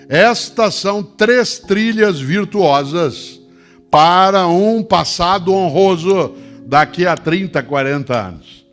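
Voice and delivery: high, loud rousing call to the audience; booming motivational speech. Acted. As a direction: lift the crowd with a big appeal. Emphatic summing-up of the virtues.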